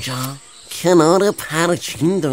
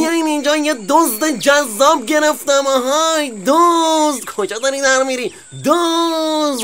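alarm call; 9 semitones higher than usual. Acted. Fox summoning the farm to the fleeing weasel, high calling out. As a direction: keep shouting for everyone to come.

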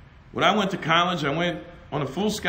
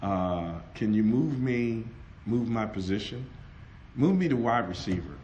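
humble request; 10 semitones lower, 7 dB quieter than usual. Retold or imitated. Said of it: "I said".

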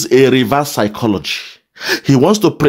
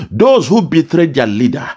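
ordinary talk, nothing marked

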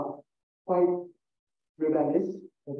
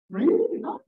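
mock disbelief; 5 dB louder than usual. Mock disbelief at Zacchaeus' gift.

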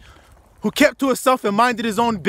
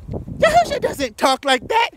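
much higher, slow, scoffing voice; mock objector; 14 semitones higher, slower than usual. Preacher mimicking a listener who doubts him, mocking. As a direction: whine it like a doubter.

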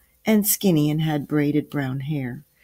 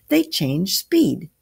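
slow, higher, emphatic reveal; exclaiming; 4 semitones higher, 4 dB louder, slower than usual. A narrator's reading. Grandfather finishes explaining how light travels, exclaiming. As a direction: land each word as a big reveal. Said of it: "exclaimed Samuel".

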